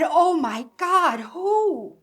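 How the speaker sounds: high, loud shocked cry; shocked gasp. Retold or imitated. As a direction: gasp out the question in alarm. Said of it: "absolutely hysterical".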